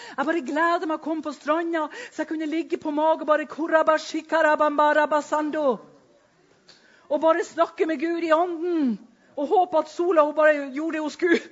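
loud, high, bursting with joy; loud ecstatic rush into tongues; joyful excitement. Retold or imitated. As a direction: spill it out ecstatically. Joy, speaking in tongues on beach.

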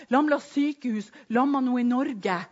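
normal talking voice

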